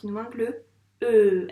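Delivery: le e appuyé, plus fort; stress on e. Shown as a demonstration. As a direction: faire ressortir le e. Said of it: "qu'est-ce qui nous manque?". (le e bien appuyé)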